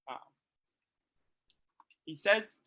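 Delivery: normal talking voice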